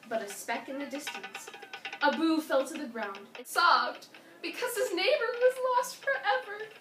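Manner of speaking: plain reading voice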